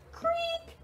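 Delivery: high squeaky door creak; playful creak for the children; creaky door. Pretend. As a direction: make the shed door creak. Door of shed opening.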